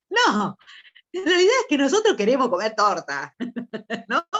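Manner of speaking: hablar riéndose, más agudo y fuerte; loud, high-pitched, amused confession through laughter; entre risas. Real, not acted. Laughing at her own cake example. (confesión divertida entre carcajadas)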